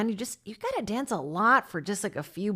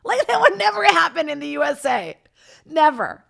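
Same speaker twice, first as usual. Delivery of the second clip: emphatic; 9 semitones higher, 8 dB louder than usual. Real, not acted. Exclaiming about the USA.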